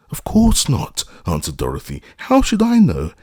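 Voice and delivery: put-on very high girlish voice; little girl. A narrator's reading. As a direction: answer like a startled young girl. Little girl answering the witch.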